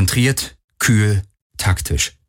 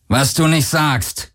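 slow, higher-pitched growl; growled retort; 6 semitones higher, slower than usual. A narrator's reading. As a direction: growl it back with sarcasm. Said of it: "knurrte Akina". (langsam geknurrt)

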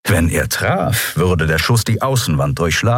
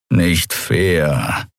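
dejected; 3 semitones lower than usual. A narrator's reading. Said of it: "dachte der ehrenwerte Chasu ... mit hängenden Schultern".

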